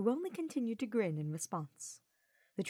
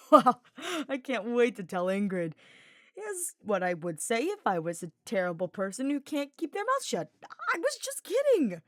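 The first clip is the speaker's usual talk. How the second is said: teasing; 6 semitones higher, 8 dB louder than usual. A narrator's reading. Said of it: "Sylvain hastily added".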